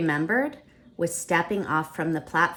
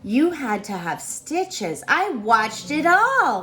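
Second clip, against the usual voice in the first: high, slow, louder eager telling; excited; 8 semitones higher, 5 dB louder, slower than usual. Acted. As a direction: brother thrilled to report. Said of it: "he said excitedly".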